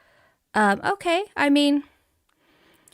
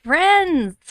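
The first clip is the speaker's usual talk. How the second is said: high, gushing, drawn-out welcome; real bubbly excitement; excited greeting; 7 semitones higher, 4 dB louder, slower than usual. Real, not acted. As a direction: greet everyone thrilled, voice lifted. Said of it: "I'm so excited".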